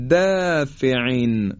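دافعین with thin fronted "dæ", drawn out; slow recitation of دافعین, keep دا light; light tarqiq. Shown as a demonstration. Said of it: "باز اینجا ترقیق و نازک ادا شد".

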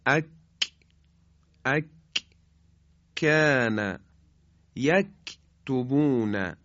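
firm forward k in "اک، کان، یک"; kaf articulation. Shown as a demonstration. Teacher shows how kaf is pronounced, firm kaf. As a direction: close off the k in "اک" firmly, just forward of qaf. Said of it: "به این شکل".

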